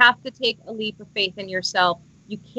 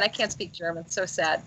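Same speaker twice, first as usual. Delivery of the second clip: ordinary talk, nothing marked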